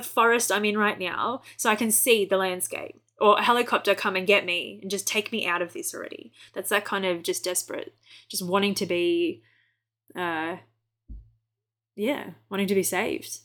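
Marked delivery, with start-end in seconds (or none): none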